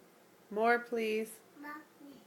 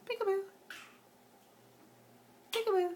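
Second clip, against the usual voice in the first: Peekaboo sung up high and falling; bright high-pitched peekaboo game voice; high singsong peekaboo; 9 semitones higher, much faster than usual. Shown as a demonstration. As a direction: lilt it high for a toddler. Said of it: "Say peekaboo".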